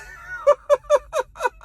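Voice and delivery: very high, drawn-out fake sobs; mock sobbing. Fan mourns Monet, exaggerated fake sobbing. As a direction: break into hammed-up boo-hoo sobs.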